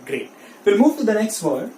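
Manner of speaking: ordinary talk, nothing marked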